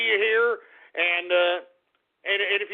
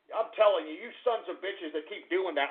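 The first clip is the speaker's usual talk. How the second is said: ordinary talk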